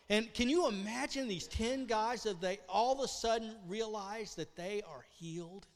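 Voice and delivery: ordinary delivery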